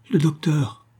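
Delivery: a dying woman's weak, scornful cry; faint cry. A narrator's reading. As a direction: scoff at the mere word docteur. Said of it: "La malade jeta un faible cri".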